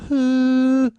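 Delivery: high, loud held tone; pitch pipe note. Retold or imitated. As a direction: imitate a singer's pitch pipe. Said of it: "singer does a pitch pipe".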